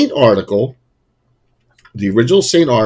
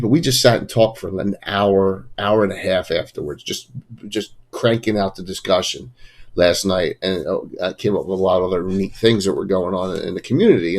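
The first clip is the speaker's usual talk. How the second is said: usual speaking voice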